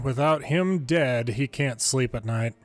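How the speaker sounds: ordinary delivery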